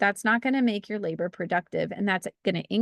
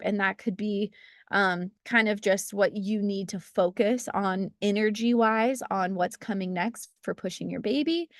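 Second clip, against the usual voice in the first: ordinary talk, nothing marked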